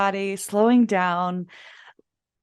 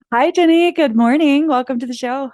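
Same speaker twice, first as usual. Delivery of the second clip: bright, loud, high greeting; delighted; 5 semitones higher, 7 dB louder than usual. Real, not acted. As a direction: greet her with real joy. Greeting guest on show.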